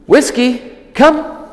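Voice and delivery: high, loud call across the yard; high dog-recall call. Shown as a demonstration. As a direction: call her name bright and high. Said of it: "When you call her to come".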